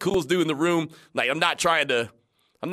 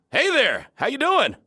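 coach greeting; 11 semitones higher, 4 dB louder than usual. Retold or imitated. Voicing Coach Gruden greeting.

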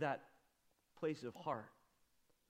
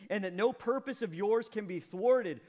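loud and high, earnest; preacher voicing Job's answer to the Lord; fervent confession; 6 semitones higher, 7 dB louder than usual. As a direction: proclaim it with full conviction. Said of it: "Job answered the Lord".

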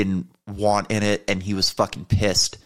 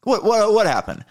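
higher, pressing questions; imitated insistent prodding; 10 semitones higher than usual. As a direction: coax and needle, pitch climbing. Imitates security chief pressing for answers.

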